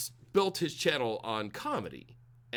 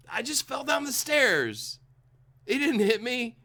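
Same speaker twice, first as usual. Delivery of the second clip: high-pitched, pretend-innocent excuse; mock battered partner; 9 semitones higher than usual. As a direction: play a partner making excuses, hammed-up.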